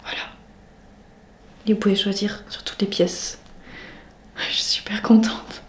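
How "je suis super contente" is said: soft, slow whisper. Real, not acted. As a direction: whisper it like a secret. Confiding in the camera.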